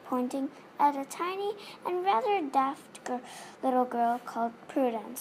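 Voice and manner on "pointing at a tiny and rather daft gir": usual speaking voice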